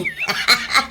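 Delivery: sudden high-pitched laugh; shrill burst of giggles. Acted.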